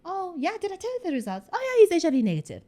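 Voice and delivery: mocking a doctor's too-casual news. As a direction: rattle it off as if it's trivial. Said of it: "he did it also so casually".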